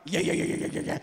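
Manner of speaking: soft, breathy babble mimicking gossip; mock whispered chitchat in the pew; mock chatter. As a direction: imitate a neighbour yakking through the sermon. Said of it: "Ay, yung katabi mo naman".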